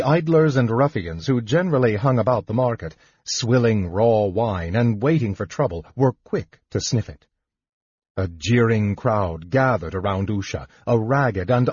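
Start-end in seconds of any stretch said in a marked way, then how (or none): none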